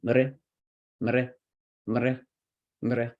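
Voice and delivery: short "mr", r as the vowel; syllabic r. Shown as a demonstration. Teacher drilling one syllable, syllabic-r "mr". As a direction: say "mr" as its own clipped syllable. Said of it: "this is its own syllable".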